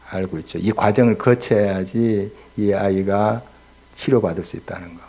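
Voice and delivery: ordinary talk, nothing marked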